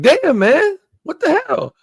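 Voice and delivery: offended woman. Retold or imitated. Reviewer voices Autobio offended, very high mock outrage. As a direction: squeak it out, hurt and offended. Her offended reaction acted out.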